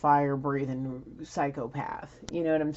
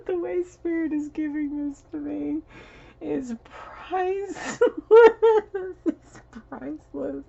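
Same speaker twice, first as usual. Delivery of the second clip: talking through high bursts of laughter; can't stop giggling at what she's seeing; laughing; 12 semitones higher, 8 dB louder than usual. Real, not acted. Laughing to the viewers at Spirit's message. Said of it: "I'm laughing because I don't know how else to react".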